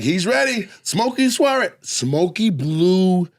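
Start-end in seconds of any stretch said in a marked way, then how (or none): 0.0-1.7 higher hyped-up host intro, announcing the next comic. Retold or imitated. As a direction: stretch out Smokey Suarez like a big host intro. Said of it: "And then Rodney's like".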